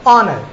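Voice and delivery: ऑनर opening on the vowel, no H; silent H. Shown as a demonstration. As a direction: start ऑनर on "aw", dropping the H. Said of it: "इनका pronounce किया जाता है".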